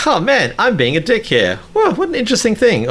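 mock carefree musing. Host recalling his bulletproof self while editing, mock carefree musing. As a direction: play your oblivious old self, high-voiced.

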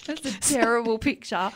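amused laughing remark; laughing comment. Real, not acted. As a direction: giggle it out. Laughing at her own joke.

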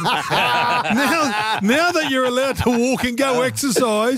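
incredulous. Real, not acted. Incredulous rant about parks.

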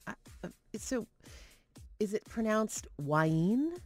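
hesitant question, "Wayne" as why-EEN; mispronounced name. Retold or imitated. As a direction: tentatively stretch "Wayne" into two syllables, why-een. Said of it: "and she says".

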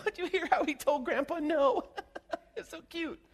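high, cooing, giggly doting parent. Retold or imitated. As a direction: coo like a proud mom over a toddler.

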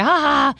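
high, spoken "ha ha ha"; imitated laugh. Friend retelling an inside joke, imitated laugh.

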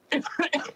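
harsh sick cough; a real chesty cough; coughing fit. Real, not acted. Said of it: "sorry for in advance for coughing".

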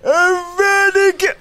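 hammy villain cry. Acted. Voldemort role, acted scene.

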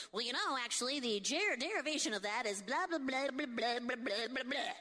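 know-it-all history buff. Retold or imitated. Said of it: "those history people".

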